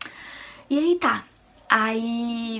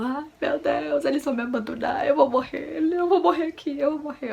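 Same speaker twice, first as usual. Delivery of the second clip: panicked wail; 6 semitones higher than usual. Retold or imitated. Labouring woman sure she will be abandoned, panicked wail. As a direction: wail it high, acting out panic. Retold despair during labour.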